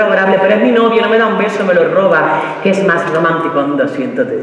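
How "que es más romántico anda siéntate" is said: normal talking voice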